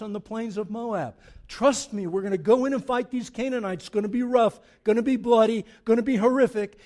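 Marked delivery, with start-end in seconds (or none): 1.5-6.8 rising to a loud forceful cry; loud urging. Acted. God rallying the Israelites before battle, loud and forceful. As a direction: push it hard, like a commander rallying troops.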